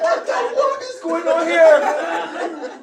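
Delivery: loud, high, drawn-out bewilderment through laughter; amused disbelief. Retold or imitated. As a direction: exclaim in mock shock, half laughing. Retells his amazed reaction, laughing.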